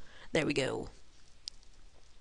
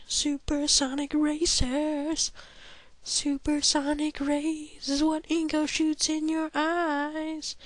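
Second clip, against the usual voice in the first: high, slow goofy narrator voice; high silly commentary; 8 semitones higher than usual. Acted. Player mocking Pokemon logic, high and slow. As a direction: ramble in a raised silly voice.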